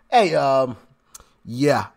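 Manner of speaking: ordinary talk, nothing marked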